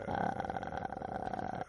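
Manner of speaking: unvoiced breathy ah; voiceless friction. Shown as a demonstration. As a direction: friction sound with no voice. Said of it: "Sin llegar a emitir sonido".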